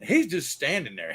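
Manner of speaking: ordinary talk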